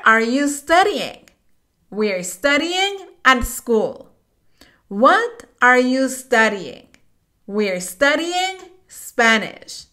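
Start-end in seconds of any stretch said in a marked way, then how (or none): none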